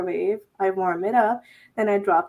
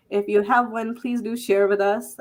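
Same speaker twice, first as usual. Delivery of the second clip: ordinary talk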